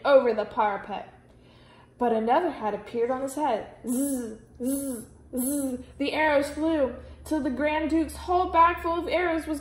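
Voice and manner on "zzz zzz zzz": ordinary delivery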